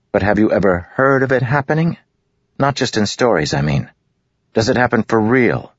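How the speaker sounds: a hint of a restless lad demanding an answer; impatient village boy. A narrator's reading. Boy Nagget pressing Cal.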